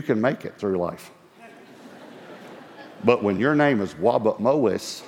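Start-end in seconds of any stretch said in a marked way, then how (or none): 3.9-5.0 "Wabut Mowis" with w sounds for the r's; says w for r. Shown as a demonstration. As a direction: say "Wabut Mowis" as a child who can't say r. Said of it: "I couldn't pronounce my R's".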